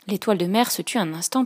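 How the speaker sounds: usual speaking voice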